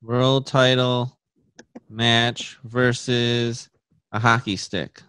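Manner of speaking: slow, stunned repeat; slow disbelief. Real, not acted. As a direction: let it sink in, word by word. Stunned at absurd matchup.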